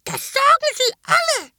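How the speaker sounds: put-on very high, loud old witch nagging; shrill scolding. A narrator's reading. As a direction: snap it like a cranky old hag. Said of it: "keifte die Frau".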